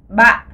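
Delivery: ba falling and cut short in the throat; glottal falling ba. Shown as a demonstration. Teacher models ba with a heavy, glottalised fall. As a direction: drop ba, then stop it hard in the glottis. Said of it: "on le prononce ba".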